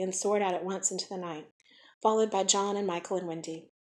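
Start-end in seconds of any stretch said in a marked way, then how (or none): none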